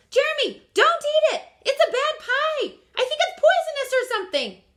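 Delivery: loud, high, urgent warning; frantic cry to save a friend; panicked shout. Acted. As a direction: yell it out to stop him eating.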